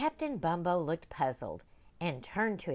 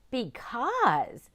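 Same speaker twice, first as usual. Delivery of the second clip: put-on young girl; 7 semitones higher, 7 dB louder than usual. Girl voiced.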